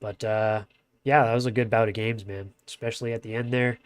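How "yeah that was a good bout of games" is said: ordinary talk, nothing marked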